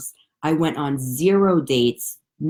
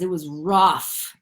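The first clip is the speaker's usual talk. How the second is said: slow rough; slower than usual. Real, not acted. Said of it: "Oh, fuck, you guys".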